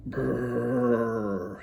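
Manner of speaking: lips buzzing brrr from the cold; shivering brrr. Acted. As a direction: shiver out loud. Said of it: "he says".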